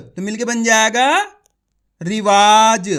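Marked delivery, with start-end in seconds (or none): 2.0-2.9 loud, drawn-out "रिवाज" with a long वा; syllable-by-syllable reading. Shown as a demonstration. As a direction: stretch the वा in "रिवाज" loudly, as for learners. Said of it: "इसी तरीके से पढ़ना आपको".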